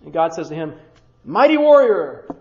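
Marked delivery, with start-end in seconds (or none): 1.2-2.3 mock grand address. God addresses the trembling Gideon.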